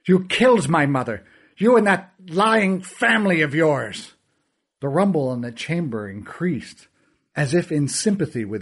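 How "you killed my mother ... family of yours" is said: rising fury, higher at the end; angry accusation. Acted. Fergal blames the narrator's family, rising angry accusation. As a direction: throw the blame at him.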